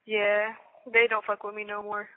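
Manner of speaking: usual speaking voice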